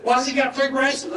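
a high, put-out youth protest; complaining boys. Retold or imitated. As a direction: whine it like jealous teenagers. Boys complaining about the bracelets.

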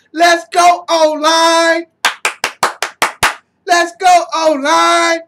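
loud, high rallying yell; cheering shout. Real, not acted. Fan cheering on the O-line with a loud high shout. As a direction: fire up the linemen.

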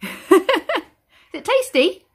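slow laughing question. Real, not acted. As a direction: giggle through the question.

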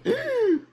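high, theatrical gasp; shocked gasp. Pretend. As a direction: gasp sharply in mock shock. Mock shock at opponent's move.

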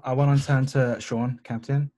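ordinary delivery

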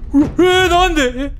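high, loud shocked cry; 驚いて叫ぶ; shocked yelp. Real, not acted. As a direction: yelp in disbelief at the hit. Shot fails to hurt enemy.

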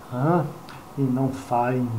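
ordinary talk

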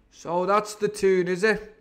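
cook crying out. A narrator's reading. Silver answers the cheering crew, crying out. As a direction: call it out as a knowing sea cook. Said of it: "cried the cook".

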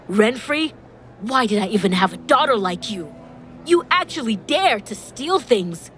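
narrator's restrained roar; angry roar. A narrator's reading. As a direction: berate a shameful daughter. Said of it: "David roared angrily".

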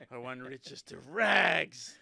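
playful mimic of a gloomy colleague; mocking a colleague. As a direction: drag it out like a sulky boss making a demand. Mocking the colleague's odd request.

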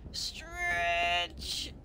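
low, very drawn-out stretch; elongated, dropping urging; longer stretch. Acted. Urging the creature, second longer take.

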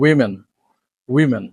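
women as WI-min, short i first; correct pronunciation. Shown as a demonstration. Teacher models the plural, correct pronunciation. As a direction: say women as "WIM-en". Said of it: "but the plural form is".

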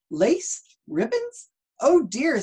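high, rising shocked cries; shocked dismay. Acted. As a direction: squeak out each in disbelief. Mrs Rogers finds dressed meat.

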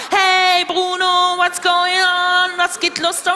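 loud, high, drawn-out holler; high stretched-out shout of greeting; mock hyped-up calling. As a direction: hail a pet like a party host. Hailing a fish in its glass.

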